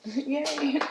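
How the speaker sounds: usual speaking voice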